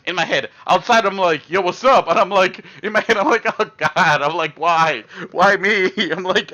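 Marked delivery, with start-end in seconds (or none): 1.5-2.1 mock cool greeting. Acting cool outwardly. 3.6-5.9 mock dread. Inner dread facing big guy.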